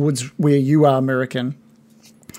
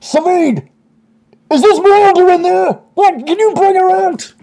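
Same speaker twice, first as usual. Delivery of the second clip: loud, very high shout from outside; calling out; 6 dB louder than usual. Acted. Voice outside calls to Savid about Miranda, shouting high and loud. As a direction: holler through a door to someone far off. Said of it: "you hear that voice".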